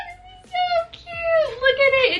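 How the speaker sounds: very high, tearful squeal; squealing delight. Real, not acted. Vlogger thrilled by a little notebook, squealing. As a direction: let it burst out as a high squeal.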